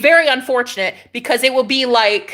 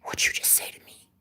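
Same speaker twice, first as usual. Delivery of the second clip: the same question whispered; 13 dB quieter than usual. Shown as a demonstration. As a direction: hush it like a secret taunt. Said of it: "repeated as whisper".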